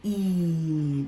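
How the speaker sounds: "E" held as a long "ee"; long E sound. Shown as a demonstration. Teacher introducing the second E A sound, long ee. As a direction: stretch "E" out steadily. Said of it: "that gives the sound".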